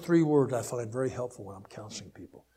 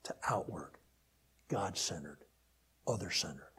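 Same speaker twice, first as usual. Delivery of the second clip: soft whispered "outward", falling; 8 semitones lower, 8 dB quieter than usual. Acted. Preacher contrasting inward and outward, whispered. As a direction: drop to a hushed whisper.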